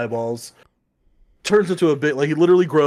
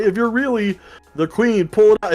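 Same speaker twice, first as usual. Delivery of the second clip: challenging king; 8 semitones higher, 5 dB louder than usual. Acted.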